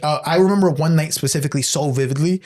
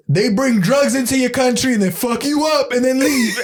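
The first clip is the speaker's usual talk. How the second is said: imitating the guy, pitched up; retelling what he said in a higher put-on voice; mock quote voice; 7 semitones higher than usual. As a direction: do his voice as you quote him. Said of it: "he goes".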